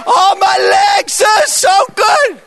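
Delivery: high, loud, stretched-out mimicked shriek; overjoyed shouting. Healed cashier telling the preacher across the shop, ecstatic shriek. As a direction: holler her delight, drawing out the words.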